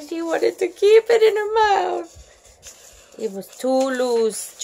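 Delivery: usual speaking voice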